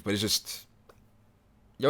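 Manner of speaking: ordinary talk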